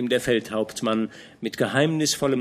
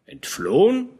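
"Entflohen?" high and rising in disbelief; "Entflohen?" staunend, steigend gefragt; surprised echo; 8 semitones higher, 6 dB louder than usual. Acted. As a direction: repeat "Entflohen?" as a startled question. Fronsberg echoes in surprise.

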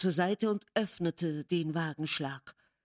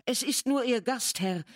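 higher, slightly raised call; quick call; 6 semitones higher than usual. A narrator's reading. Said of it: "rief ich schnell".

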